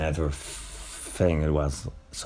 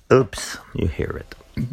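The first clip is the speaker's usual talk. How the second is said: ordinary talk, nothing marked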